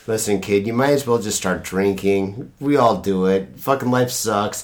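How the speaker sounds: very low, softened world-weary voice; mock life-is-hopeless mentor; jaded adviser. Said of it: "I thought you'd be like".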